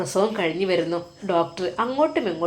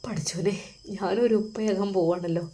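whispered, much softer, overcome with emotion; tearful whisper; 3 semitones lower, 9 dB quieter than usual. A narrator's reading. New father seeing the baby, whispering through tears. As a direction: breathe the words out, moved to tears. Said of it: "അവൻ്റെ കണ്ണുകൾ നിറഞ്ഞു".

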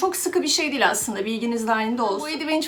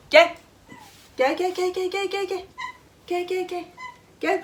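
coaxing cat call; 7 semitones higher than usual. Real, not acted.

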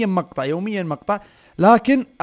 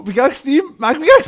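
raised pitch climbing into a loud question; insistent calling out; 8 semitones higher, 7 dB louder than usual. Real, not acted. Player asking teammate for a pickaxe, insistent rising call. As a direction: call to a teammate who is not answering.

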